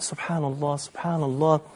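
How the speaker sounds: quiet devotional repetition; hushed dhikr. Acted. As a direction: say it softly, as private remembrance of God. Quoting a listener quietly praising God.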